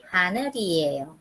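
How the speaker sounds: 하늘이에요 run together as ha-neu-ri-e-yo; linked R reading. Shown as a demonstration. Teacher repeating it for students, fluently linked. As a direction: say 하늘이에요 in one flow with a tapped R. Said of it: "sino como una R".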